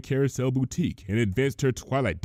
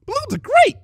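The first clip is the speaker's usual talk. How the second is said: high, loud gleeful squeal; 9 dB louder than usual. Acted. Twilight squealing about balloons. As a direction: squeal with delight. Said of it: "squealed Twilight".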